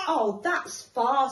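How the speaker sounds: very slow, drawn-out wonder; big hare impressed, slowly; slow marvelling. Acted. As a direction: stretch it out in awe.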